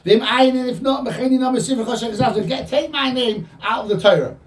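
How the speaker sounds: high, urgent plea in a changed tone; impassioned demand. Retold or imitated. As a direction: plead insistently on the people's behalf, pitched well up. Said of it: "changes his tone completely".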